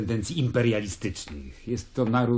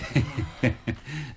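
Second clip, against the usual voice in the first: short laugh; 3 semitones higher, 4 dB quieter than usual. Acted. Laughs at the birth year.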